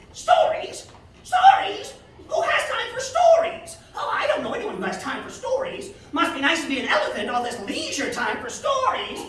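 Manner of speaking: high, laughing, scornful baboon voice; mock the very idea of stories; scoffing mother baboon. A narrator's reading.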